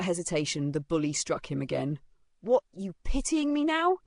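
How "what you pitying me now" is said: high, provoked sneer with rising end; taunting challenge. Acted. Bully strikes the boy again, taunting. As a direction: challenge him aggressively.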